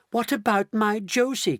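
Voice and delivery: high, anxious protest; crying out. A narrator's reading. Grandpa Joe to Mister Wonka, crying out. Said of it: "cried Grandpa Joe".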